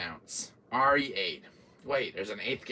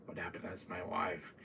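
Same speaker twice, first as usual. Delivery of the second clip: soft, low, worried murmur; quietly alarmed, under your breath; mild hushed dismay; hushed worry; 5 semitones lower, 11 dB quieter than usual. Retold or imitated. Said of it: "I'm like".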